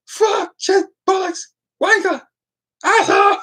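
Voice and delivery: high-pitched bursts of mock involuntary swearing; swearing dog collar. Dog's collar swearing at random, high mock outbursts. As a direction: blurt each curse separately, very high.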